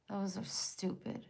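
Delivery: quick soft whisper. Real, not acted. Streamer whispering asides to the chat. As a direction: mutter it under your breath.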